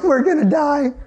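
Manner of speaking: high-pitched cry of doom; mock panic. Family reacting after dad calls them sissies, mock panic. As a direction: let the voice jump up in dread.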